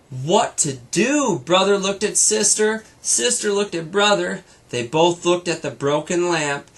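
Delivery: lively read-aloud storytelling voice; brighter, fuller storybook reading; story narration. A narrator's reading. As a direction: read it like a bedtime story to a child. Reads story to child.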